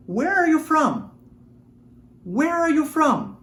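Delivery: "Where are you from?" slow and high, stress on "are"; slow model for repeating. Shown as a demonstration. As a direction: say it slowly so they can copy. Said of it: "Repita comigo". (modelo lento e agudo para repetir)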